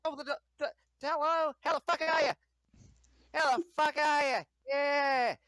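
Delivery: high, loud giddy party bro; over-excited party dude. Acted. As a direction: near bursting with excitement at the party. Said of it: "You sound like you're, like, milliseconds away".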